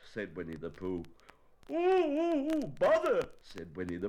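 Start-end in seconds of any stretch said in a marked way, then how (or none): 1.7-3.3 high, loud bear wail; hammed-up cry of dismay; distressed bear. Pooh stuck and upset.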